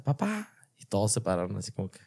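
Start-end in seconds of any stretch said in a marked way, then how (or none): 0.0-0.5 put-on high called-out papá like a little girl across the field; child calling from afar. Daughter calling her dad across a football field. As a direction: call it out high from far away. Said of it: "Y desde lejos me grita".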